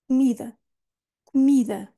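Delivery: Comida with the mi held and stressed. Shown as a demonstration. Teacher modelling Comida for learners, stress on mi. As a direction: lean on the mi of Comida. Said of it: "é aqui que nós vamos focar a nossa atenção".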